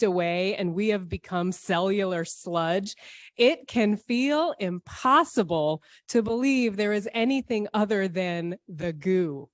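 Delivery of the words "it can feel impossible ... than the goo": usual speaking voice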